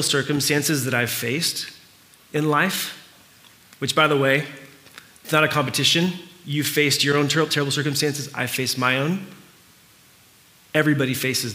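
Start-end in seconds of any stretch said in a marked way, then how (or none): none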